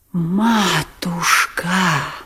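a drawn-out wail of despair; вопль Лебедева. A narrator's reading. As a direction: wail the word out slowly. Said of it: "всё ещё вопил Лебедев". (протяжный вопль)

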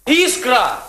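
high, urgent call of a name; calling after her. Acted. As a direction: call out after someone walking away. Calling after her as she leaves.

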